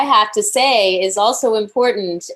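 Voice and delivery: insistent retort. Retold or imitated. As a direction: insist on your right to speak. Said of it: "And I was like".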